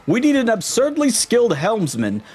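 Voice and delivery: high, pompous ship captain voice, stretched vowels; pompous captain Flavio. Acted. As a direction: show off the ship like a vain aristocrat.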